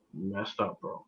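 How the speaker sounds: very low, hushed grumble; dejected muttering. Retold or imitated. Fan replaying his draft night to friends, dejected muttering. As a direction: mutter it under your breath, way down low. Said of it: "Why you say it like that".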